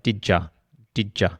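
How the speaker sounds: did you run together as Did ya; connected speech. Shown as a demonstration. Teacher showing how did you sounds in fast speech. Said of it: "that would all be like".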